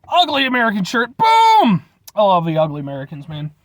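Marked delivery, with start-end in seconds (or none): 1.2-1.8 high, loud boom; excited shout. Acted. Showing off his shirt to viewers, excited shout. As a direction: shout it out at the reveal.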